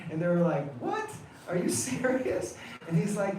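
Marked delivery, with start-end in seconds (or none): 0.8-2.6 high squeaky incredulous what; aghast, can't believe what he asks; pitched-up mock outrage; shocked disbelief. Gopis shocked at boatman's demand.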